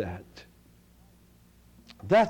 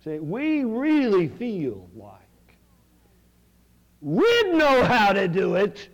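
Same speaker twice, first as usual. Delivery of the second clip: emphatic preaching; 8 semitones higher, 6 dB louder, much slower than usual. Acted. Preaching at full force.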